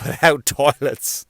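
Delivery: usual speaking voice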